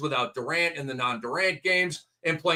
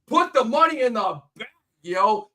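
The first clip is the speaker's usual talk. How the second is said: higher, louder swaggering player voice; loud cocky mock swagger; 6 semitones higher, 5 dB louder than usual. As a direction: play the paid star, cocky and loud. Voicing Ayton's attitude.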